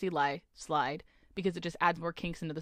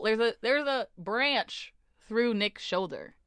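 usual speaking voice

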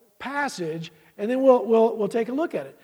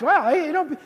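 ordinary talk

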